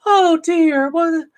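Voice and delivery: high breathy falsetto swoon; mock damsel falling head over heels; swooning girl. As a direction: gush like a lovestruck teen girl.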